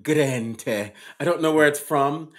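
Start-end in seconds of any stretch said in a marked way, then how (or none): 0.0-0.9 green tea with an open, Irish-Jamaican lilt; odd mixed accent. Shown as a demonstration. Host repeats his accidental accent for his co-host. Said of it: "you see what I did with my accent?".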